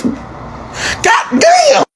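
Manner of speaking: drawn-out, high stunned exclamation. Retold or imitated. Learning there are seven siblings, stunned. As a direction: stretch it out high in mock astonishment.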